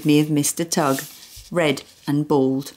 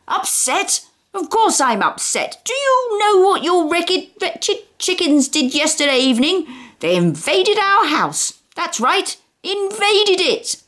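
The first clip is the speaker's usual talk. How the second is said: furious rant; 14 semitones higher than usual. Acted. Mister Tugg ranting.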